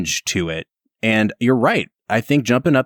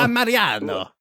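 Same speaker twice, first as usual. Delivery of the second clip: put-on Italian accent; 9 semitones higher than usual. Joins the Italian accent bit.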